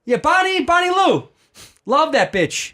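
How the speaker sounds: high, loud mock loudmouth; super loud. Airport fan getting the name wrong, super loud. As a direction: blurt it loudly, oblivious to everyone near. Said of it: "was talking super loud".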